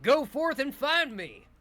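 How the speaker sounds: loud gloating villain taunt; booming echoing villain monologue. Acted. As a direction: boom it out like a hologram villain. GM voicing villain Feastwood.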